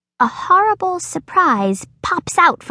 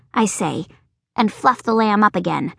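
ordinary delivery

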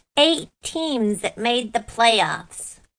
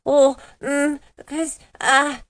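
fussing; 4 semitones higher than usual. Acted. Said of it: "I start to make a fuss".

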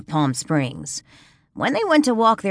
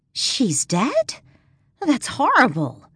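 shocked gasp; 8 semitones higher, slower than usual. Acted. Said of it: "Danielle gasped".

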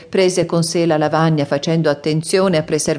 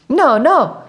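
high, loud cry of protest; alarmed shout; 9 semitones higher, 4 dB louder than usual. A narrator's reading. Teacher shouts to stop the elephant erasing, alarmed. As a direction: shout to stop him at once. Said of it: "urlò il maestro di scuola".

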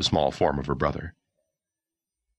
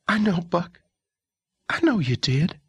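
comforting sister. Acted.

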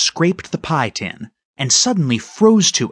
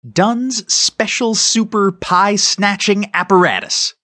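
grand, higher-pitched slow proclamation; proud announcement; 5 semitones higher than usual. A narrator's reading. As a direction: announce it like a marvellous invention, drawn out. Boy grandly names his invention.

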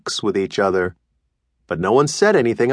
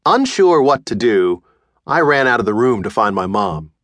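ordinary delivery

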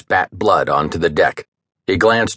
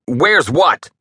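higher, fearful retort; harsh with fear; 8 semitones higher than usual. A narrator's reading. Merchant captain facing the pirate, fearful and harsh. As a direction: answer with frightened defiance. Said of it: "voice sounded harsh with fear".

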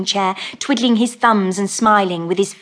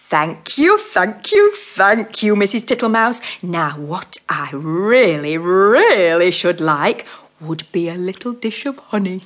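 slow, fat, comfortable toad voice; fat-voiced toad; 3 dB louder, slower than usual. Mr Jackson makes himself at home, slow fat toad voice. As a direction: settle in like a contented fat toad.

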